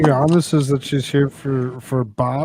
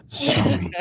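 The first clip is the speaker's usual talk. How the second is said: whispered close to the ear; breathy whisper; 12 semitones higher than usual. Retold or imitated. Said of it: "feel the hot breath on my ear".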